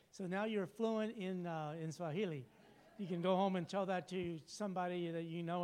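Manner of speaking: normal talking voice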